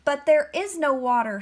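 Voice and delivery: ordinary delivery, nothing marked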